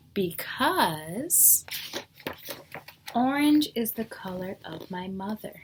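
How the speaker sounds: lively storybook reading with rising questions; teacher reading a picture book to the class; reading to children. Acted. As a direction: read each guess like a curious cat. Reads the picture book aloud.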